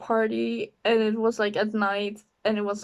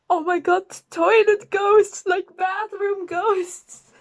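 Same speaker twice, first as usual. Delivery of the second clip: higher, slow spooky excitement; mock thrilled; 8 semitones higher, slower than usual. Friends hyping up toilet ghosts, higher and slow. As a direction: savour the silly idea.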